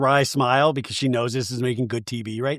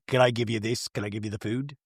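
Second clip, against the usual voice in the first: low, very soft imitation; mock timid offer; 5 semitones lower, 7 dB quieter than usual. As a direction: offer it meekly, almost under your breath. Retold timid server in the fight.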